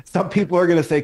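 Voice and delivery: ordinary delivery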